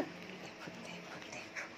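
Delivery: softly whispered look. Real, not acted. Owner whispers to viewers to watch the dog. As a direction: hush and point it out in a whisper.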